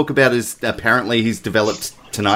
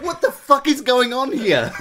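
incredulous; 11 semitones higher than usual. Retold or imitated. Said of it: "I'm like".

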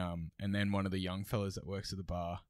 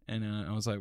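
ordinary talk, nothing marked

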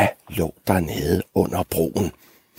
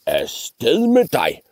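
slow, raised dismissal; grumpy troll; 4 semitones higher, much slower than usual. Acted. Troll dismissing the middle goat, slow cross order. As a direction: shoo them off crossly, drawn out. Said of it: "svarede trolden arigt".

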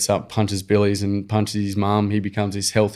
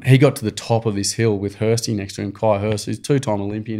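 ordinary talk

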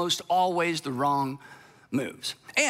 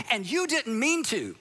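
ordinary delivery

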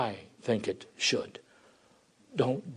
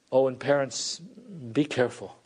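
normal talking voice

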